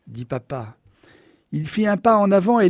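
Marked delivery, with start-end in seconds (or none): none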